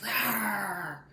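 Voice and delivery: drawn-out growled roar; beast roar. Acted. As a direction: roar like a wild animal. Imitates wild beasts.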